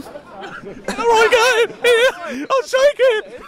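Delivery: high-pitched teasing echo; mock disbelief. Real, not acted. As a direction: echo the words in mocking disbelief. Mocking teammate's faint praise.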